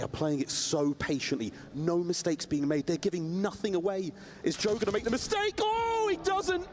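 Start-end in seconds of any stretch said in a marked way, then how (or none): 4.4-6.6 excited call. Real, not acted. Caster call of a clutch moment.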